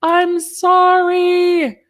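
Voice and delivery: high, drawn-out sorry; mock-sheepish high squeal of sorry; squeaky apology. Real, not acted. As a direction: whine the apology up high and stretched. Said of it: "which I didn't".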